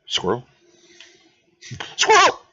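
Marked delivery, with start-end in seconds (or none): 2.0-2.4 high, loud squawk; high shout. Acted. Game character calls out Squirrel, high shout.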